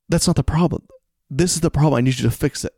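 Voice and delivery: high, loud, frustrated plea; exasperated insistence. Acted. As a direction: hammer the point home in mock frustration. Acted frustration at wrong fix.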